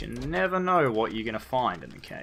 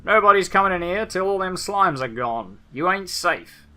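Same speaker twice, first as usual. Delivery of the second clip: put-on rough Australian accent; 4 semitones higher, 5 dB louder than usual. Old patriarch yelling a warning at strangers, in a loud Australian bogan accent. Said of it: "got to Australian towards the end".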